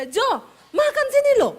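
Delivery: high loud yell to dinner; loud high call. Retold or imitated. Wife calling husband to eat, loud high call. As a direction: belt it out up high. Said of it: "Orang Sulawesi kalau ngomong nadanya tinggi terus".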